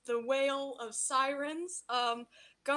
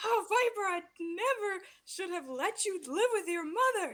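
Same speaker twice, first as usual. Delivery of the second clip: whining villain father; 7 semitones higher than usual. Acted. Villain father lamenting to Viper, high and theatrical. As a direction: moan it like a wounded tyrant. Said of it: "he's like".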